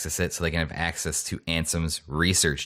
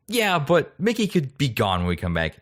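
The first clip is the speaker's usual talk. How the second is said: lightly voiced heroes; 8 semitones higher than usual. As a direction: lightly voice the gang, higher. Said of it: "they're like".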